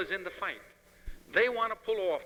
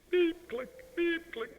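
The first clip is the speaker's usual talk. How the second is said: high, breathy beep-click projector noise; toy-like beep and click sound effect; imitated projector beeps; 11 semitones higher, much slower than usual. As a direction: mimic a film strip projector ticking along. Film strip projector noises.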